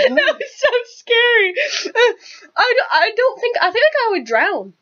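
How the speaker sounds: high, teary and shaky; laughing off the fright; shaken. Real, not acted. Shaken after scary moment.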